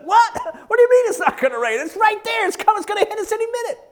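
high, loud scoffing workers; mocking disbelief. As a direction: toss it off as laughing skeptics. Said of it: "they laughed. Like".

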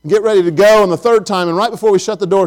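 normal talking voice

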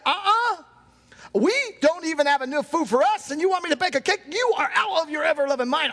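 high-pitched, hammed-up indignation; mock outrage at a cheeky request; indignant refusal. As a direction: refuse like an affronted woman. Acts out widow's indignant refusal.

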